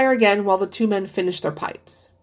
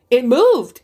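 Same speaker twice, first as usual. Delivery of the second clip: louder startled cry of It moved; cried out; 4 semitones higher, 6 dB louder than usual. A narrator's reading. Old man crying out after the paw twists, loud. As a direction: blurt it out in shock. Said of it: "he cried".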